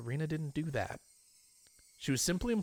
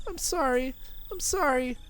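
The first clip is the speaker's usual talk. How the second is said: desperate apologies; 14 semitones higher than usual. Acted. Rena repeating sorry to Keiichi, high and pained. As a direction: plead over and over.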